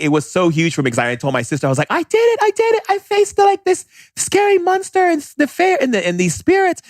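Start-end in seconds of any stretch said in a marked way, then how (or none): 1.9-6.8 very high, giddy cry of triumph; excited squeal. Retold or imitated. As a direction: squeal like a kid who just won. Telling sister about beating spirits.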